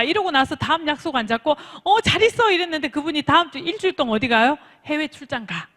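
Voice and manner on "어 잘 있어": high, loud carefree goodbye; cheery farewell. Acted. Coach acting a parting without fixing an appointment, cheery. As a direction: toss off a blithe goodbye.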